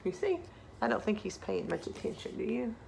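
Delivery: usual speaking voice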